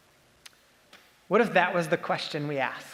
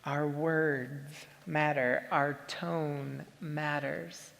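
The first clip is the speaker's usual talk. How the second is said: slow, soft and deliberate, every word weighted; slow emphatic statement; 3 semitones lower, 6 dB quieter, much slower than usual. Real, not acted. Pastor to congregation, driving home the point slowly and softly. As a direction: let each word land slowly and quietly.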